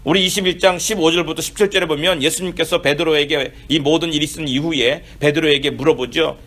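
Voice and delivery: ordinary talk, nothing marked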